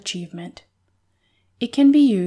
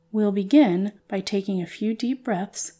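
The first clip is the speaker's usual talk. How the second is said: soft guided meditation voice; calm meditative tone; 5 dB quieter than usual. Acted. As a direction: ease them gently into calm. Leads a guided visualization.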